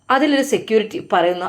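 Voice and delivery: usual speaking voice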